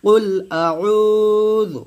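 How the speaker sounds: slow chanted কুল আউযু; slow recitation. Shown as a demonstration. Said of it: "এখানে টান দিবেন না".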